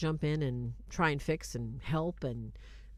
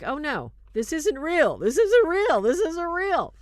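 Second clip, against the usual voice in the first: building, higher and louder, in rising panic; panicked cry growing much higher and louder; panicked denial; 10 semitones higher, 10 dB louder than usual. Retold or imitated. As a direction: repeat it like a man losing his grip. Man panicking in asylum vision.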